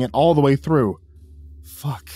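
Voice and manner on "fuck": muttered curse. A narrator's reading. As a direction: mutter it softly to yourself. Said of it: "I muttered".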